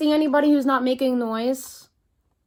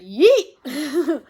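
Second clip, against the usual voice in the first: loud yeet; one more shout to be heard; 5 dB louder than usual. A narrator's reading. Boy shouts again from the tower, loud yeet. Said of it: "he shouted out".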